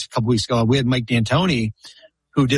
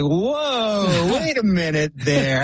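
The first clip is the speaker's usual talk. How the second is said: high, drawn-out "whoa" of disbelief; mock alarm; 6 semitones higher, slower than usual. League balking at the billion-dollar pitch, in mock alarm. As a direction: put the brakes on in an exaggerated, high-pitched protest.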